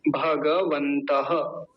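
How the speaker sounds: भगवन्तः very slow with a clear final breathy ह after the visarga; slow careful pronunciation. Shown as a demonstration. Teacher modelling a Sanskrit word for learners, very slow. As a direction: spell out भगवन्तः slowly, sounding the visarga. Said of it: "बिल्कुल वही है".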